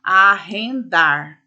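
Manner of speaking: arrendar stretched slowly syllable by syllable; split into syllables. Shown as a demonstration. Teacher dictating arrendar to the class, slow syllables. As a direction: spell out arrendar one slow syllable at a time. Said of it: "Por exemplo".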